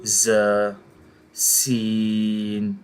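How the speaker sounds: measured Quranic alphabet recitation, each letter held long; recitation demo. Shown as a demonstration. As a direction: chant each letter slowly on a steady tone. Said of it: "پہلے میں آپ کو پڑھ کے سناتا ہوں".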